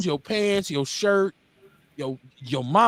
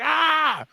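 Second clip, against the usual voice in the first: high, frustrated "ah!"; high-pitched burst of frustration; frustrated yell; 10 semitones higher than usual. Retold or imitated. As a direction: cry out like an exasperated mother. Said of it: "my mother was gonna be frustrated".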